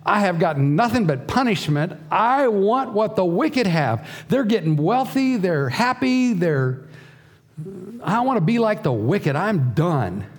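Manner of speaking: the psalmist's bitter outcry, pitched high; voicing the despairing psalmist. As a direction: rant like a man fed up with God. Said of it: "Just up the page, he's saying".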